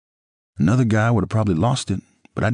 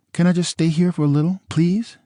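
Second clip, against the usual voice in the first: much higher, pleading woman's voice; ex-wife begging to stay the night; Nicole pleading; 12 semitones higher than usual. A narrator's reading.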